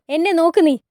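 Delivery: loud, high, forceful order; angry command. Acted. Hurrem ordering the weeping girl to look up, fiercely. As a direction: demand attention in anger.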